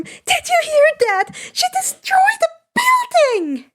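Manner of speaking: very high giddy question; thrilled disbelief. A narrator's reading. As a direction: squeal it in delight. Schoolgirl gushing excitedly.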